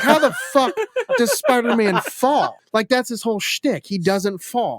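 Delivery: high-pitched incredulous rant, half laughing; comically exasperated gamer complaint; retold exasperation. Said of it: "that pissed me off".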